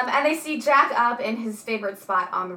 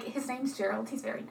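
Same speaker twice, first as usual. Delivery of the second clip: mock bashful; 12 dB quieter than usual. Voicing flustered Louise.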